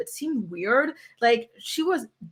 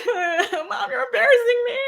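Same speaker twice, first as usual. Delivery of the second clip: mock crying teen wail, pitched way up; 13 semitones higher than usual. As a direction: whine it like a mortified kid about to run off in tears. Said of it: "I would run off crying".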